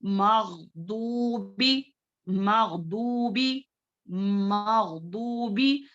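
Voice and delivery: मगदूबी with a heavy Arabic dwad, slow; slow correct recitation; dwad not dal. Shown as a demonstration. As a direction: stretch मगदूबी, dwad pressed against the side of the tongue. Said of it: "दाल की तरह नहीं पढ़ेंगे".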